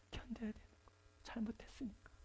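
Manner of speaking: hushed whisper; preacher whispering that you must endure it. Real, not acted. Sermon drops to whisper.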